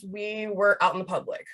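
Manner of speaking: ordinary talk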